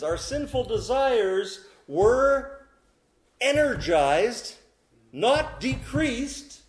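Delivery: fiery pulpit climax; full-force preaching. Acted. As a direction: preach it hard and slow.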